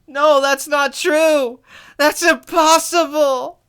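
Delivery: high, loud, slow mock wail; hammed-up anguish. Joking with a famous movie line, melodramatic denial. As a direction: draw out each word in melodramatic denial.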